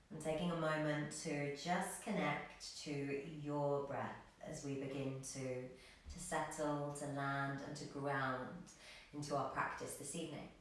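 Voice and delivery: hushed, low-key settling guidance; guided meditation. Acted. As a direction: ease them into stillness, quiet and unhurried.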